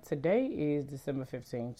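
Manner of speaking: ordinary talk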